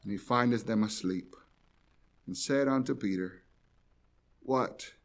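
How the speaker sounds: soft, hushed reading of the verse; quiet scripture reading. Acted. Preacher reading verse forty to the congregation, hushed and low. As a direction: let the words sink low and quiet. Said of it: "and it says".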